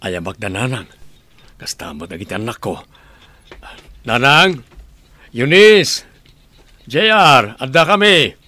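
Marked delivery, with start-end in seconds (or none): 4.0-8.3 loud high calls into the house; calling out loud. Acted. James calling his mother and kids from the yard, loud high call. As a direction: shout for the family to come out.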